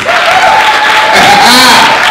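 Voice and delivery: hearty laugh. Real, not acted. Laugh before crowd cheer.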